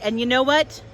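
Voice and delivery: ordinary delivery, nothing marked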